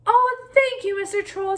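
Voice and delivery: high, grateful squeak; little goat thanks the troll for letting him go; relieved little goat. A narrator's reading. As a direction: sound small and relieved.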